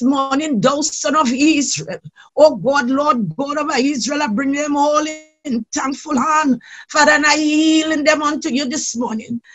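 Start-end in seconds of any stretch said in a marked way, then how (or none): none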